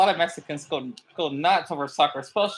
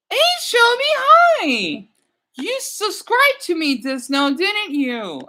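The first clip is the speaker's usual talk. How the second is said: high, loud, bubbly hello; light up for the new fan; excited greeting; 12 semitones higher, 7 dB louder, slower than usual. Real, not acted. Greeting new subscriber Shelby, high and excited.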